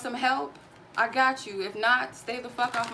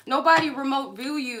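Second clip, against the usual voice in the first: high, loud scornful yell; real anger, higher and louder; furious shout; 5 semitones higher, 6 dB louder than usual. Real, not acted. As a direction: blast it at him. Yelling at harasser on live.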